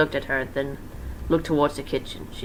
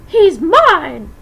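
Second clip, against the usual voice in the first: much higher, loud possessive snarl; angry Vixie; 11 dB louder than usual. Acted. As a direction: claim him furiously. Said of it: "said Vixie angrily".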